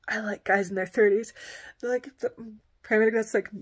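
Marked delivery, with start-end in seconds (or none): 0.1-1.3 hushed flirty whisper. Retold or imitated. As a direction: whisper it like a secret.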